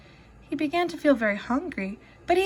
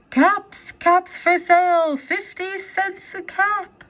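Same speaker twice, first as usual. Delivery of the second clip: street vendor call; 7 semitones higher, slower than usual. Acted. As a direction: call out your caps to the town. Peddler calling wares again.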